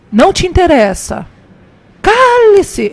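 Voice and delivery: mock indignant retort, rising to a high, loud command; curt rebuke. Boy snubbing the shop mother, loud high command. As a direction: imitate a boy cutting a woman off.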